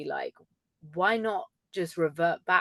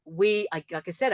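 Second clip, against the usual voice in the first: ordinary delivery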